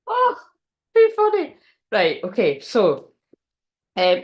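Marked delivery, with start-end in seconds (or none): 0.1-1.6 amused. Real, not acted. Still laughing at thumbnail idea.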